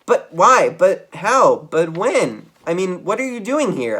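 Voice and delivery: high, loud flustered questions; stammering shock. A narrator's reading. Geronimo finding his nephew in the kitchen, stammering in shock. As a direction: sputter out one question after another. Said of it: "I stammered".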